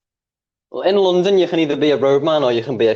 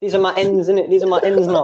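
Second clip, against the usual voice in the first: high put-on roadman voice; mock street-baby protest; newborn roadman; 6 semitones higher than usual. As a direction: swear like an indignant baby roadman. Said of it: "comes out the womb talking".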